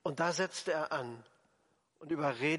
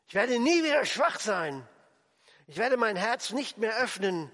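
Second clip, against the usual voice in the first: higher, louder defiant vow; innerer Schwur; 5 semitones higher, 5 dB louder than usual. Acted. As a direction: swear it to yourself, voice raised. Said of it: "ein innerer Schwur, den zeige ich jetzt". (trotziger, lauter Schwur)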